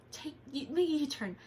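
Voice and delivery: lightly mimicked robotic GPS voice; a hint of a navigation voice giving directions. Imitating the GPS telling her to make a U-turn. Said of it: "and then it said".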